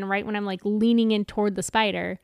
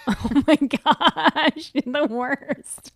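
laughing groan; 6 semitones higher, 6 dB louder, much slower than usual. Real, not acted. Groaning at husband's fart joke.

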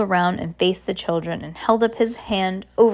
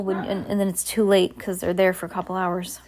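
usual speaking voice

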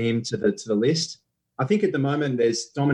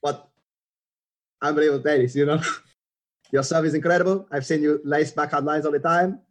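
put-on higher, Spanish-accented, eager and friendly; Rafa impression; 4 semitones higher than usual. Rafa inviting the guest to hit cross court, Spanish accent. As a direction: gush about her serve in a light Spanish accent. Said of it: "try not to do Rafa".

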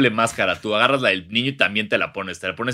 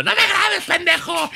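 high, mock tearful yell; crying girlfriend; 3 dB louder than usual. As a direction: yell at the boyfriend filming you. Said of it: "De ella llorando". (novia llorando, grito agudo fingido)

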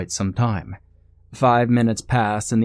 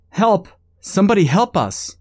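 much higher, louder desperate scream; yelling for help; 11 semitones higher, 6 dB louder than usual. Acted. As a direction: call out across the empty road. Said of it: "yelled at the top of her lungs".

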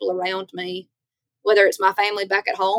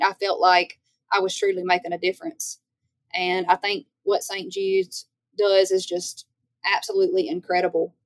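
normal talking voice

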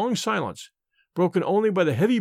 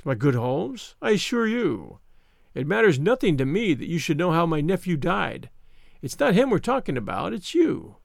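Mister Smith, smug. Acted. Visitor who sniggered at sick Holmes, smug. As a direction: sneer at the helpless patient. Narrator voices sneering visitor.

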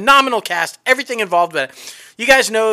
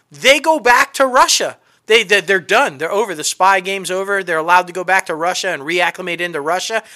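ordinary delivery

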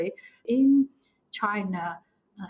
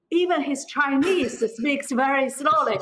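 louder, higher, laughing through the words; real laughter breaking into the line; amused laughing; 6 semitones higher, 6 dB louder than usual. Real, not acted. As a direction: deliver the punchline through a laugh. Joking about a slow-speaking partner.

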